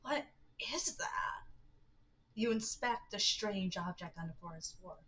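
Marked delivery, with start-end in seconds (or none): 0.0-1.4 whisper. Acted. Spooky laugh from the woods.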